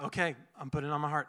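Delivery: fast, breathless compliance; quick, keen agreement; fast eager reply. Retold or imitated. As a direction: rattle it off quickly, eager to please. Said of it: "I was like".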